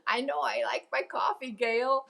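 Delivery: ordinary talk, nothing marked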